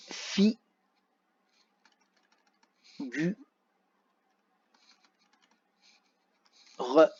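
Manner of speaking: "Fi. Gu. Re." as lone syllables with long pauses; syllable-by-syllable dictation. Shown as a demonstration. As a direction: say "Fi", wait, "Gu", wait, "Re". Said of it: "on va écrire les syllabes". (« Fi. Gu. Re. » isolées, longues pauses)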